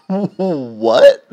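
high, loud laughing What; laughing disbelief. Real, not acted. Hearing Panera ran out of bread, laughing disbelief. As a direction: blurt it out through a laugh.

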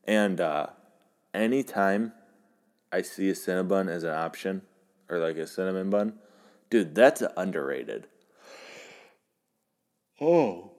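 normal talking voice

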